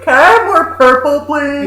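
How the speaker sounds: put-on high, loud pleading kid; mock child begging. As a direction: beg for a refill like a child. Mock begging for more drink.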